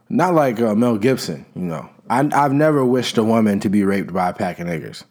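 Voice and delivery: normal talking voice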